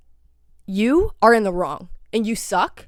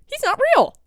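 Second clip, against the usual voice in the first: high-pitched mocking quote; mock denial; 3 dB louder than usual. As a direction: imitate her in a squeaky-high mocking pitch.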